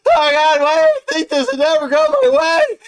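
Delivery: very high, loud falsetto wail; mock despair. Friend voicing Amanda's regret, loud high wail. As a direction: wail it like a ruined woman.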